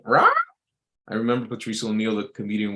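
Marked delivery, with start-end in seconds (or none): 0.0-0.3 short harsh mock screech; pterodactyl screech. Imitating a comedian's joke about women, pterodactyl screech. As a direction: squawk like a pterodactyl. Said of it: "he called them, uh, pterodactyls".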